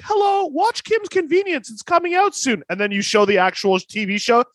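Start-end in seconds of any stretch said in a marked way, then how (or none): 0.0-2.6 much higher mock promo voice; mocking the sitcom mom. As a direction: squeak it up like a cheesy promo mom. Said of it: "the mom's like".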